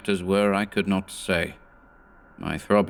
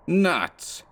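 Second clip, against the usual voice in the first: sharp high scornful retort; scoffing "Nuts!" around a cigar; 10 semitones higher than usual. Acted. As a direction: spit it out in scorn. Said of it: "Rigetti was scornfully biting".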